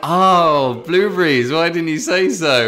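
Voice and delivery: loud, high exclamation of recognition; exaggerated "oh, now I see"; sudden realisation. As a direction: imitate a clerk who finally gets it. Retold clerk finally understands.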